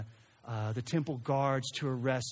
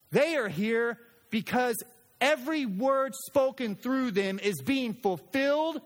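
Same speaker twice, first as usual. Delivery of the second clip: God's voice, higher and louder, firm; 7 semitones higher, 4 dB louder than usual. Acted. God correcting Peter on the mountain, firm and loud. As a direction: speak as God setting Peter straight.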